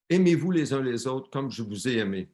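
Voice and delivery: usual speaking voice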